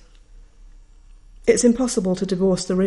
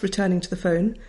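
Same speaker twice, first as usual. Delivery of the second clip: usual speaking voice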